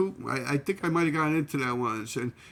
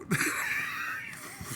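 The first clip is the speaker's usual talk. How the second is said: softer, high-pitched laugh; real high wheezy laugh; high giggle; 6 dB quieter than usual. Real, not acted. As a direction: giggle helplessly at the memory. Laughs at pigeon argument.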